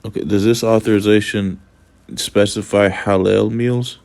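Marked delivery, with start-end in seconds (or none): none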